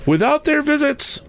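very high, fervent spectral voice; solemn warning ghost. A narrator's reading. Ghost come tonight to warn Scrooge, solemn high voice. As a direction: warn him high, as a spirit offering escape. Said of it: "said the ghost".

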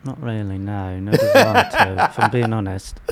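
put-on British accent. Turning down a trip to London, in a British accent. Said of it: "That's how they talk".

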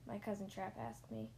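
usual speaking voice

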